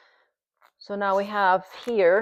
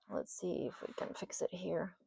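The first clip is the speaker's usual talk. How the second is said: whisper; 18 dB quieter than usual. Real, not acted. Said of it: "aside while fixing board".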